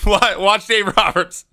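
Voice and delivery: laughing. Real, not acted. Laughing at favorite clip moment.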